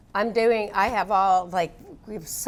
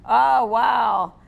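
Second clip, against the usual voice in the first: ordinary delivery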